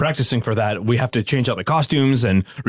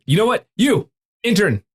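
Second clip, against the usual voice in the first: bossy director; 7 semitones higher than usual. Acted. Boss orders intern around.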